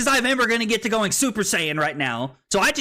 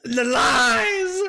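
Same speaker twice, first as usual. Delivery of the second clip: mock outrage; 7 semitones higher, much slower than usual. Mock betrayal after drinking not water.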